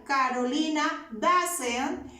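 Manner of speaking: slow, spelled-out model sentence; each word laid out slowly for students; slow dictation. Shown as a demonstration. Teacher modelling the negative for the class, slowly. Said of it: "Y negamos el auxiliar".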